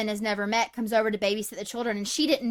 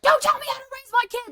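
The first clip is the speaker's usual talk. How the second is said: high, loud retold yell; yelling dad; 12 semitones higher, 3 dB louder than usual. Father snapping at the other mother who questions him, high angry yell. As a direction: bark it back like an offended father. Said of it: "a lot of yelling happening".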